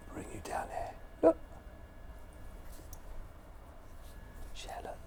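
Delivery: soft whisper to the dog. Real, not acted. Streamer whispering to the dog while moving the camera, hushed. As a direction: hush it so the dog stays calm.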